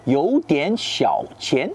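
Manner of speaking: you dian xiao qian said slowly in Mandarin with its tones; Mandarin phrase. Shown as a demonstration. Teacher giving French viewers the Chinese phrase, slow Mandarin. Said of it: "ont un peu d'argent".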